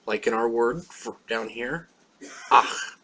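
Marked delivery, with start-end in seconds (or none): 2.5-3.0 "ach" with a scraping ch from deep in the throat; throaty ch. Shown as a demonstration. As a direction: demonstrate "ach" loudly, ending as if hocking a loogie. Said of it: "pronounced way down in the bottom of the throat".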